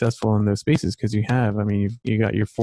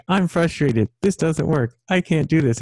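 higher, mock frustrated kid; imitating his son; 7 semitones higher than usual. Dad repeating his son's words to him, higher and whiny. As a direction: echo the boy's whine back. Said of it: "you're talking like I was talking".

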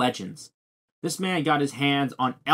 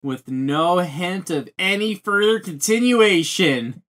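higher, heated complaint; exasperated rant; 4 semitones higher, 4 dB louder than usual. Real, not acted. Viewer demanding one more episode, exasperated rant. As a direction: rant with raised pitch and emphatic stretches. Ranting about the missing episode.

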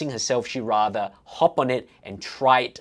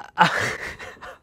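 short burst of laughter; real laugh; 2 semitones higher than usual. Real, not acted. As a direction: break into a brief laugh. Laughing at fail clips.

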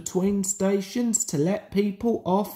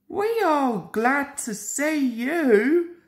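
put-on higher, slow childlike voice; children calling; 8 semitones higher, 4 dB louder, slower than usual. A narrator's reading. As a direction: glad little voices calling out. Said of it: "called the children".

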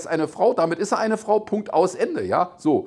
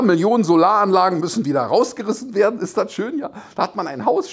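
usual speaking voice

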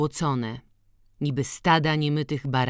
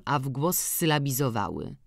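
ordinary delivery, nothing marked

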